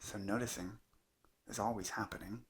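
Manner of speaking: unhurried meditation teacher, long pauses between phrases; calm, measured guidance in short spaced phrases; guided meditation. Acted. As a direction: let each phrase settle into a long silence. Leads a guided meditation talk.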